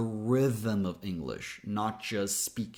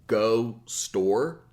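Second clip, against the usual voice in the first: louder blunt caveman shorthand, only key words; caveman talk; 5 dB louder than usual. Shown as a demonstration. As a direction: grunt it like a caveman, just content words. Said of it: "Think like cavemen here".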